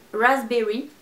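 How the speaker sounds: "Raspberry" retried as a self-mocking English raz-berry; clumsy English accent. Shown as a demonstration. Vlogger retries the shade name in bad English accent. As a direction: have a go at the English "Raspberry", knowing it won't sound right. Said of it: "Accent anglais de merde".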